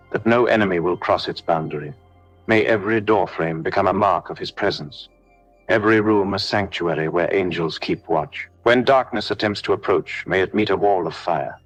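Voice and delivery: ordinary delivery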